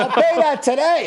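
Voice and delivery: high, loud, laughing disbelief; yelling out in the courtroom with a laugh; excited yell. Retold or imitated. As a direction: blurt it out like you can't believe your luck. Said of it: "And I yell out".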